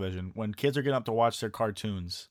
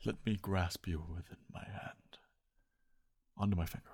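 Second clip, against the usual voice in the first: creepy whisper; 5 semitones lower, 12 dB quieter than usual. Pretend. Coaxing a spider onto his hand.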